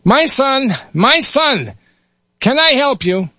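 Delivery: put-on high, gentle Yiddish-accented voice; Yiddish rabbi. Rabbi greets the dying lad, gentle Yiddish accent. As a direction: soothe like a kindly rabbi. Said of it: "switch between dialects here".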